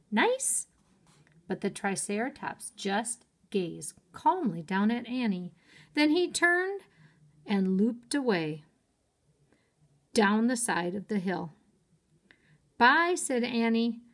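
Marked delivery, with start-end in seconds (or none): 0.1-0.7 high, disbelieving question; incredulous. Acted. Jack incredulous. 12.8-13.1 higher, louder cheery bye; calling out. Acted. Annie calling to dinosaur.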